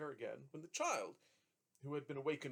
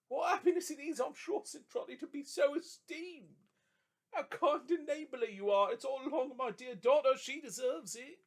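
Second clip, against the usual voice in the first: overjoyed thanks; 11 semitones higher, 8 dB louder than usual. A narrator's reading. Trotty overjoyed, touched.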